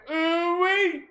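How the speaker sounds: whistle it out, impressed; a squealed ooh-wee of disbelief; amazed ooh-wee. Real, not acted. Reacting to Indiana's numbers.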